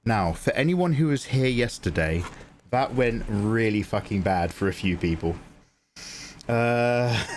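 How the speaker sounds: ordinary talk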